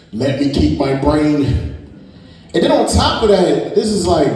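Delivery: normal talking voice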